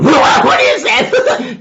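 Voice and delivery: loud, high, drawn-out scream; mock panic. Animals frightened by a noise in the forest, hammed-up panicked scream. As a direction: shriek it in hammed-up terror.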